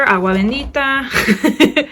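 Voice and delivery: laughing. Real, not acted. Joking about holy water.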